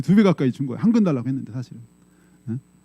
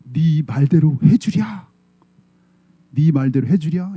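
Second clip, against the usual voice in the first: slow, measured solemn words; slow divine voice; slower than usual. Acted. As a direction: speak each word slowly like a voice from above. Holy Spirit's voice to mother. (성령이 엄마에게 천천히 엄숙하게 말함)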